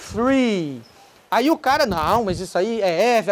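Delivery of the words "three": "three" drawn out, TH running into R; "three" with joined TH-R. Shown as a demonstration. English teacher joining the sounds, long careful "three". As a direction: slide from tongue-tip TH into R on "three". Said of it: "você emenda e fala".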